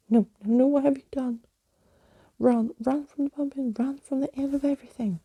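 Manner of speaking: high, frantic cry, speeding up; panicked wail. A narrator's reading. Unmasked skeleton wails at everyone to flee, frantic. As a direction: beg them to run in terror.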